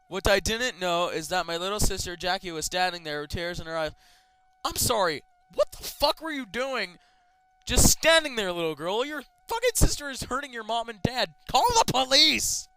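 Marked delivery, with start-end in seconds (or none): 4.6-12.7 loud, high scolding of the character; louder, higher exasperated shouting; outraged yelling. Real, not acted. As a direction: yell at the sister to act. Yelling at the little sister.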